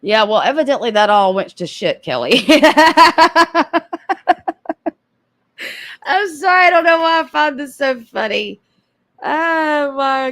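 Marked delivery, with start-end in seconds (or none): 2.5-4.9 loud, high burst of laughter; cracking up out of control. Real, not acted. Laughing at the chef joke with a loud burst.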